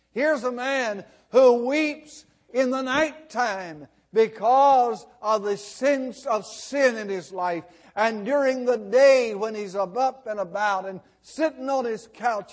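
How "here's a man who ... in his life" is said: ordinary talk, nothing marked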